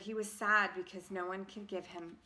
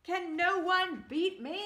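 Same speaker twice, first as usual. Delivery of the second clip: lamenting hero; 9 semitones higher, 6 dB louder than usual. Acted. Paper laments.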